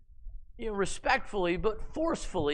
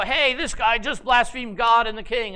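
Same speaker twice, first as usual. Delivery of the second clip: louder and higher false outcry; loud accusation; 5 semitones higher, 6 dB louder than usual. Acted. Scoundrels loudly accuse Naboth at the fast. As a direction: cry out against him publicly. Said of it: "they go".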